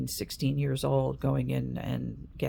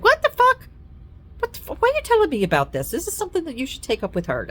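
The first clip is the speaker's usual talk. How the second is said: much higher, louder, fast shocked cry; shocked outburst; 7 dB louder, much faster than usual. Retold or imitated. Grown child reacting in shock to her mother's news. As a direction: blurt it out in disbelief.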